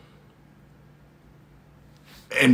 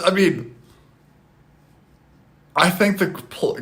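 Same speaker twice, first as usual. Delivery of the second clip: ordinary talk, nothing marked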